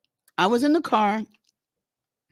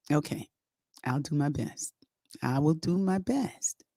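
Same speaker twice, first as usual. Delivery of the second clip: quiet reluctant agreement; 5 semitones lower, 8 dB quieter than usual. Retold or imitated.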